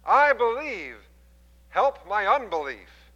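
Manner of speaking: loud, high, slow plea; desperate father cries out to Jesus; crying out. Retold or imitated. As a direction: cry out "I believe" from the heart. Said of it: "cried out and said".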